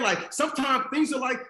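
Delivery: usual speaking voice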